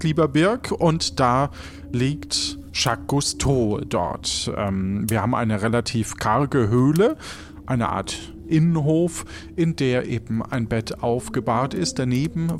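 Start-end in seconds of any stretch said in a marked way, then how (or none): none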